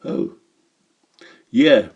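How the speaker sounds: usual speaking voice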